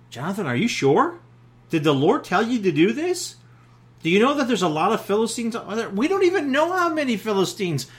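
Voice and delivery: doubtful. Pretend. Doubter questions Jonathan's plan, rising anxiously. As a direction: pile up the worried objections. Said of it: "And then doubt".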